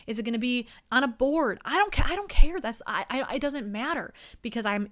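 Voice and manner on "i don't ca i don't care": high, loud careless cry; carefree shout. Pretend. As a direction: blurt it out without a care. Carefree drunk outburst.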